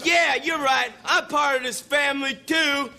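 mock self-righteous drunk; drunk boyfriend persuaded. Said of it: "So he says".

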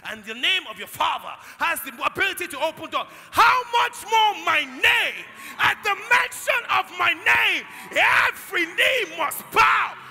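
loud, high, slow shouted sermon; the preacher roars the power of the name; full force preaching. Real, not acted. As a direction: thunder it to the congregation.

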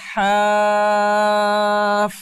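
হাফ with the হা held very long, loud, then closed on ফ; long drawn vowel. Shown as a demonstration. Teacher demonstrating four-alif madd, হাফ with a long-held হা. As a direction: stretch the হা in হাফ for four counts. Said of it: "হাটাতে চার আলিফ টানতে হবে".

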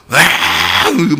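long, loud, drawn-out "으앙" roar; mock lion roar. Pastor mocks the idea of a roaring lion to the congregation, loud mock roar. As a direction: roar like a charging lion, hammed up. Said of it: "우는 사자가 막".

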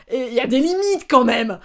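loud, high-pitched protest; scandalised outburst, pushed up and out; indignant exclamation. Real, not acted. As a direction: raise your voice in exasperated outrage. Exclaims over the boss's behaviour.

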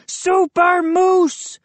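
high, slow, stretched-out hero cry; triumphant yell. A narrator's reading. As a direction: yell it like a boasting superhero. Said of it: "he yelled".

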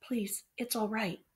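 soft, slow, tender reassurance; calm the frightened little figures; soothing whisper. A narrator's reading. Boy soothing the terrified tiny figures, soft whisper. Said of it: "he whispered".

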